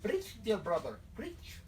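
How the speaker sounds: southern amen-corner encouragement; southern congregation member. Acted. A follower cheering on the leader, southern church response. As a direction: egg the preacher on in a southern accent. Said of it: "In that accent?".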